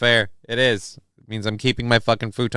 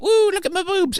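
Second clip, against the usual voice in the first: very high squeaky woo; mock her as a giddy airhead; mocking the space lady. Streamer mocking the lady astronaut, high squeaky mock voice. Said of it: "She went up there and went".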